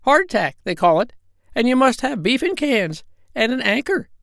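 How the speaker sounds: very high, squawky parrot voice; squawking parrot voice. Acted. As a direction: squawk it out like a bossy old parrot. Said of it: "she said".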